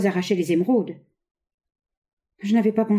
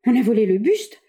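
louder, startled question; alarmed; 5 semitones higher, 5 dB louder than usual. Acted. Wife alarmed by news of the theft. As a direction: ask it in sudden alarm. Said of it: "avec effarement".

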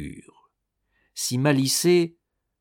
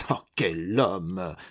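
indignant; 5 semitones lower, 3 dB quieter, much slower than usual. A narrator's reading. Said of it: "s'indignait-elle".

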